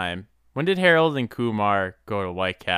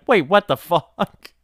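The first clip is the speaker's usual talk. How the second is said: high, loud baffled outburst; suddenly confused; surprised; 8 semitones higher, 6 dB louder, slower than usual. Retold or imitated. Retold confused reaction.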